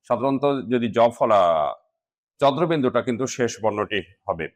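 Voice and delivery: ordinary talk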